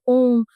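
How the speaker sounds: ओम with the O held three times as long; prolonged vowel. Shown as a demonstration. Teacher showing a pluta vowel, ओम drawn out long. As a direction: stretch out ओम slowly on a level note. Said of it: "तीन गुना समय लगता".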